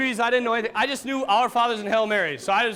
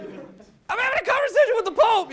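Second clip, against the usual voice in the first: laughing; 12 semitones higher, 3 dB louder than usual. Retold or imitated. Quoting himself, amazed by the Pope.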